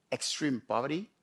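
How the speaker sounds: ordinary delivery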